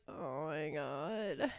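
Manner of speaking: low, drawn-out, quiet dismay; dismayed retold reaction. Host recalling being cussed out, low dismayed aside. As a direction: groan it out slow, soft and low.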